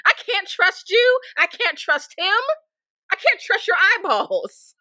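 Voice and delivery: high, loud, slow mock distrust; hammed-up shriek of disbelief; mock exasperated outburst. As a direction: wail it out like a betrayed diva. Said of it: "I'm like".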